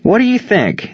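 high-pitched sarcastic retort; pointed sarcasm, pitched well up. A narrator's reading. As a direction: throw the question back sarcastically. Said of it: "the catcher answered sarcastically".

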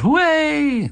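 high-pitched mock cheer; imitated festive yell, very high; dancers yelling. A narrator's reading. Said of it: "The dancers yelled".

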